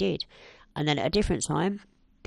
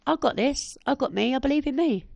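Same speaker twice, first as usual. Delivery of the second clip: raised, emphatic self pep talk; confident self-belief; 8 semitones higher, 4 dB louder than usual. Retold or imitated. Woman cheering herself on today, confident self-belief. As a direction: declare it to yourself with conviction. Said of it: "But no, today I go".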